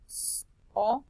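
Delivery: sombrero as separate letter sounds, starting with long sss. Shown as a demonstration. Teacher reading sombrero, sounding it out letter by letter. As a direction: sound out each sound of sombrero with gaps.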